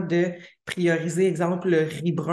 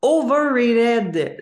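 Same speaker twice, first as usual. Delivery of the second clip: overrated said slowly and loudly with an English accent, as a heading; announced in English; 6 semitones higher, 6 dB louder, much slower than usual. Real, not acted. Host announcing the first point, loud and in an English accent. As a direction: proclaim overrated like a segment title. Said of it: "désolée pour mon accent anglais".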